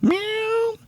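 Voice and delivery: very high cartoon cat meow. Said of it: "I just meowed".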